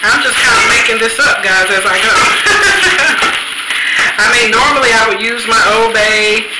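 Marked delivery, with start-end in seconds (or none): 2.2-4.2 high, airy burst of laughter; real laugh. Real, not acted. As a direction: laugh off your own improvising. Laughs at making it up.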